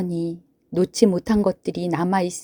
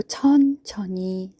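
slow; 2 semitones higher, much slower than usual. Shown as a demonstration. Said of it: "천천히 아주 길게".

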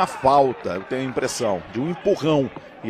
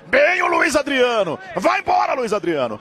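excited repeated call; 12 semitones higher than usual. Real, not acted. Repeated rising attack call.

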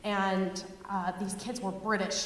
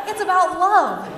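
mock earnest realisation; 7 semitones higher, 10 dB louder than usual. Mocking the audience's realisation.

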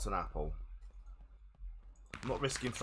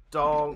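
anvil clang imitation; 3 semitones higher, 6 dB louder than usual. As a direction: ring it out like metal struck. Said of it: "Anvil's here".

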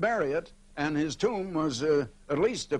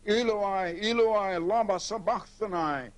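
reading a loud cry, only lightly raised; mildly raised cry; 4 semitones higher than usual. Retold or imitated. Preacher quoting Jesus on the cross, mildly raised cry. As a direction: lift the pitch a little, as if crying out. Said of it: "with a loud voice".

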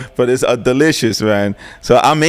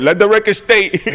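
1 courtroom voice; 8 semitones higher, 4 dB louder than usual. Pretend. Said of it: "That just sounded like he was in court".

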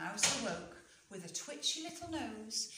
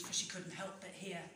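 usual speaking voice

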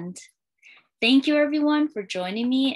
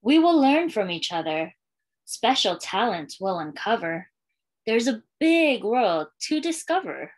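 put-on lilting read-aloud for young kids, refrain given room; storytime reading; slower than usual. Librarian reading a welcome book to schoolchildren, storytime lilt. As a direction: read it like a picture book to a class.

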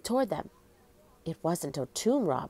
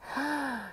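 soft gasp; 7 semitones higher, 7 dB quieter than usual. A narrator's reading. Scepter passes through ghost.

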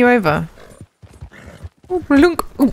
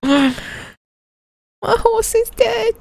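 mock wail; 11 semitones higher than usual. Real, not acted. Horse just died in game.

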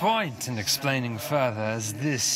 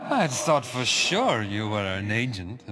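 usual speaking voice

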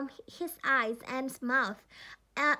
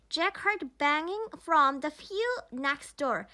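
ordinary delivery, nothing marked

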